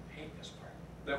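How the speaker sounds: real tears catching the voice; choked up. Real, not acted. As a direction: emotion cutting the words to almost nothing. Tearing up at memorial mention.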